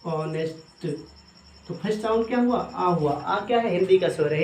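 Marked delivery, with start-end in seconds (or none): 0.1-0.9 ऑनेस्ट sounded out softly with silent H; pronunciation demo. Shown as a demonstration. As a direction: model ऑनेस्ट carefully, opening on "aw". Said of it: "क्या बोलेंगे?".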